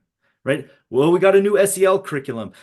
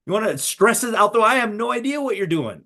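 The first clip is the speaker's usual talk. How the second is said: pitched-up, exasperated retold protest; flustered dad; 7 semitones higher than usual. Dad admits to his daughter he's lost, flustered and pitched up. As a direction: play the lost dad, half-laughing at himself.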